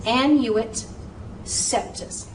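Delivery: annuit septus, septus nearly whispered; careful pronunciation. Shown as a demonstration. Teacher shows how annuit coeptus should sound. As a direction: model annuit septus syllable by syllable. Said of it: "should be pronounced".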